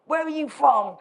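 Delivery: lightly voiced suspicious old Alaskan; grizzly old Alaskan. Old Alaskan questions the foreign motorcyclist, lightly imitated grizzly local. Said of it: "this grizzly old Alaskan guy".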